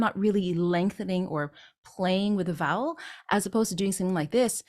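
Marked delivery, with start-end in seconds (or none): none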